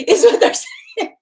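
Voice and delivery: bursts into a high laugh, then slow words. Real, not acted. Laughs at spirit's admission.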